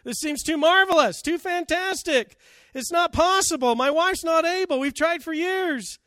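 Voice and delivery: a high, loud, agitated protest; doubting Zechariah. Acted. Zechariah questioning the angel, in loud, high disbelief. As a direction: plead in frantic disbelief, pitched high. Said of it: "He questions the angel".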